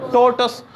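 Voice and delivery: टोटस said as TOE-tus, two short syllables; correct pronunciation. Shown as a demonstration. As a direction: say टोटस slowly and clearly, stress on the first syllable. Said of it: "कैसे?".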